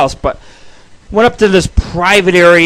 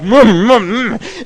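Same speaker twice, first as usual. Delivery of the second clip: muffled munching hums; greedy gobbling noises; 4 semitones higher, slower than usual. Retold or imitated. Showing how fast the hamburger was gobbled up, greedy munching noises. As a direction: imitate wolfing down a burger. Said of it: "It was like".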